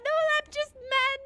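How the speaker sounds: loud, drawn-out lament rising high; loud wail. Real, not acted. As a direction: wail it out in frustration. Wailing about missing lamp.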